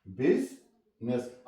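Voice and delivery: बिजनेस slowly as "biz ... ness"; short I in the first syllable of बिजनेस, then a pause; U sounded as I. Shown as a demonstration. Teacher showing U's sound, "biz ... ness". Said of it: "आई की आवाज दी है".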